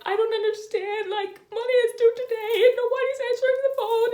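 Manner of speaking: very high, loud, fast tearful whine; crying whine. Retold or imitated. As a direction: wail it like you're about to burst into tears. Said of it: "I was, like, crying".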